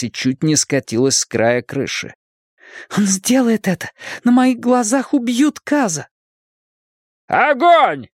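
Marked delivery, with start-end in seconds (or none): 3.0-6.1 high-pitched, urgent alarm; voiced horror at what is about to happen; panicked exclamation, pitched way up. A narrator's reading. Alarmed thought as friend faces execution. 7.3-8.1 high-pitched cry of an order; urgent yell to the gunmen; shouted command. A narrator's reading. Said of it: "выкрикнул Хейлс".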